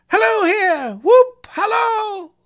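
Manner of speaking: high, loud halloo; joyous whooping. A narrator's reading. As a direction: whoop and holler with glee at full voice. Scrooge whooping and hallooing with joy.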